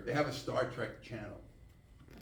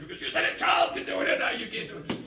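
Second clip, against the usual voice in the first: mock outraged shout; 9 semitones higher, 3 dB louder than usual. Kirk confronting the doctor.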